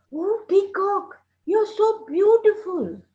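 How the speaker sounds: high, slow, admiring bird voice; admiring crow. Acted. Crow flatters the caged peacock, high admiring voice.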